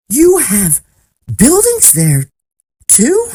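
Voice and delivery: gasped. A narrator's reading. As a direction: ask in loud disbelief. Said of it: "Horton gasped".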